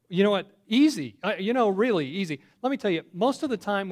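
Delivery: bright, loud burst of delight; cheerful loud exclamation; excited. Acted. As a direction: sell it like it's so easy. Philip's eager answer.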